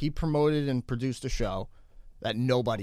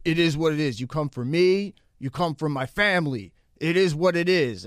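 louder, mock tough-guy threat; tough-guy menace; 4 semitones higher, 6 dB louder than usual. Imitating the gun-pulling producer as a mock tough guy. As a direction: ham up a cartoon tough guy. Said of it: "And then he's just like".

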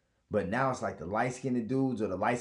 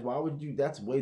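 ordinary talk

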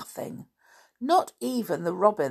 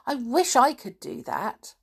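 admiring; 7 semitones higher than usual. Acted. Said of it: "said Anne admiringly".

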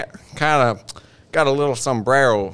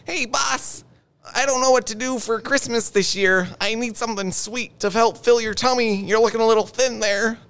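much higher squeaky elf; 11 semitones higher than usual. Acted. An elf asking boss Santa what to do for Christmas, much higher elf voice. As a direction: chirp it up high like a little elf. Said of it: "be, like, Santa Claus or elves".

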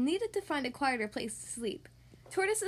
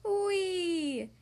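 higher, drawn-out squeal of delight; gleeful whee; 4 semitones higher than usual. A narrator's reading. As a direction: let out a long sledding cheer. Tortoise sledding with delight.

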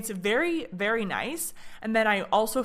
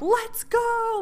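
high, bright instructor cheer; encouraging instructor; 13 semitones higher than usual. Retold or imitated. Fitness instructor encouraging the class: high cheer.

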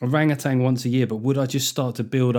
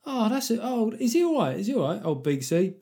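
mock disappointed; 9 semitones higher than usual. Mates learning their friend isn't coming, hammed-up disappointed. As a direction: let down and worried for a mate, hammed up high. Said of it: "everyone would be disappointed".

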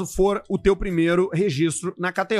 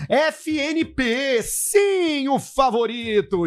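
drawn-out, high-pitched sponsor flourish; savouring announcer; 4 semitones higher, much slower than usual. Acted. Host presenting a chicken sponsor to listeners, slow savouring flourish. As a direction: stretch each word with relish.

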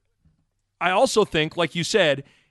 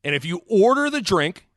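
ordinary talk

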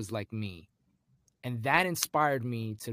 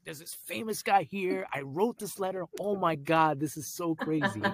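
high-pitched mild mock panic; retold freaking out; 5 semitones higher than usual. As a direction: replay your inner freak-out, pitched up. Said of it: "I'm already freaking out".

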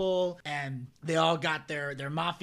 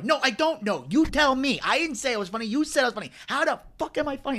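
loud, high mock-angry wiseguy; Joe Pesci; 6 semitones higher, 6 dB louder than usual. Storyteller plays Pesci turning on Henry, loud angry impression. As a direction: act out a mobster's indignant confrontation, louder and higher. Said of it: "he gets so fucking mad".

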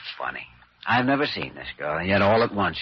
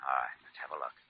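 normal talking voice

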